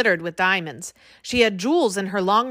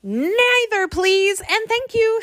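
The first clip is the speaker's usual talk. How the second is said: shouting politely; 12 semitones higher, slower than usual. A narrator's reading. Jack pleading with the king, high polite shout. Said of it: "shouted Jack".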